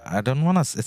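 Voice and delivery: usual speaking voice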